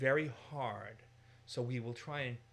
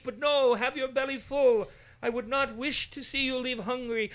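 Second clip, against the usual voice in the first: very high, loud, slow fawning servant voice; host groveling to honored guests, high and fawning; 13 semitones higher, 9 dB louder than usual. Retold or imitated. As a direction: overplay humble self-belittling hospitality. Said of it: "He's using Lord and servant language".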